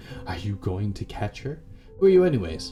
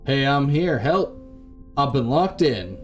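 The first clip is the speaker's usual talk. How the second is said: loud, higher-pitched call through a door; urgent shout to be let out; calling for help; 5 semitones higher, 5 dB louder than usual. Acted. Trapped captive to whoever is outside, calling for help.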